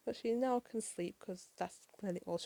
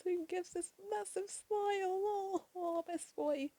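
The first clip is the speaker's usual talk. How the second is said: gushing; 9 semitones higher, slower than usual. Real, not acted. Reviewer adoring Tails's massive smile, gushing.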